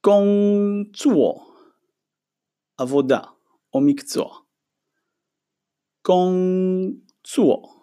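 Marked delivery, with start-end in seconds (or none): 0.1-1.5 גונגצוו said slowly, high then falling; slow Chinese demo. Shown as a demonstration. Teacher modeling Chinese for the students, slow. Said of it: "מילה הבאה". 6.1-7.8 גונגצוו said slowly, high then falling; slow Chinese demo. Shown as a demonstration. Teacher modeling Chinese for the students, slow. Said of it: "מילה הבאה".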